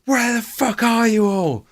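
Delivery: high, loud, slow furious yell; raging boss. Retold or imitated. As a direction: bellow at the missing staff like a raging manager. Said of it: "He'd come out and just be like".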